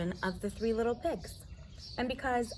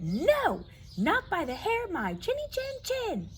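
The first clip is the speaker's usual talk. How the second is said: a pig shouting no; crying out; 9 semitones higher than usual. Acted. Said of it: "The second one cried".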